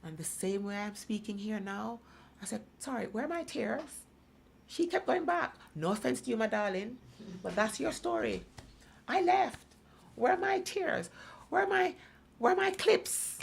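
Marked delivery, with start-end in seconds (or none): 2.8-13.4 high, indignant retort; vexed. Retold or imitated. Challenging the room over missing tears, vexed. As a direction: demand it in a raised, exasperated voice. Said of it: "I was vexed".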